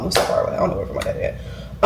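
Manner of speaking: breathy unvoiced whisper; whispered aside. Real, not acted. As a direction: drop the voice to a throwaway whisper. Aside about her absent dad.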